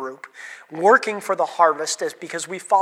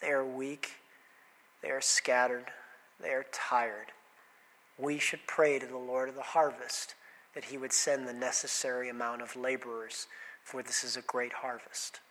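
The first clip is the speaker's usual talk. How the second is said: low, soft, tired voice with a sigh; hushed and heavy-hearted; weary sigh; 7 semitones lower, 14 dB quieter than usual. Acted. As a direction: say it like a sighing, worn-out Jesus. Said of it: "And then Jesus sighs and he looks at them".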